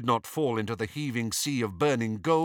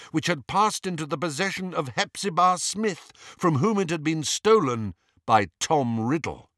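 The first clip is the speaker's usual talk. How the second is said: usual speaking voice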